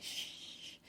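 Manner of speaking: soft unvoiced shush; retold hush while hiding. Hushing each other while hiding.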